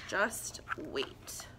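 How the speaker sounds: soft unvoiced teaser; whisper. Real, not acted. Reader whispers a teaser to the children. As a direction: hush and build suspense. Teasing the rude gnome.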